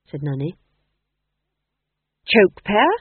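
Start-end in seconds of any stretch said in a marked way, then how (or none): 2.3-2.9 louder, higher anxious guess; desperate guess. Acted. Ghost king guessing torture devices for Nanny, desperate and louder. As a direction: grab at any answer, a bit desperate. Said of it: "he said desperately".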